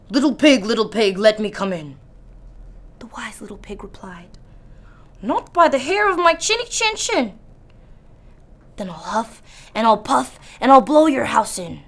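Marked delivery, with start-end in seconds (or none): 0.1-2.0 loud angry wolf howl. Acted. Angry wolf pounds on the door, howling loud. 5.2-7.4 defiant piglet. Acted. Wise pig refuses calmly. 8.8-11.8 loud angry wolf shout. Acted. Furious wolf shouts at the wise pig, loud. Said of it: "shouted the wolf".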